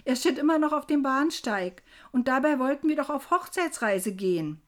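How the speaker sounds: loud, high, distressed protest; aufgebrachtes Rufen. A narrator's reading. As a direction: complain loudly to the whole compartment. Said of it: "rief ich". (laut und verzweifelt klagen)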